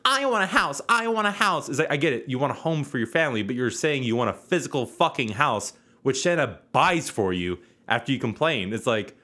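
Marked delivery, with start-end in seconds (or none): none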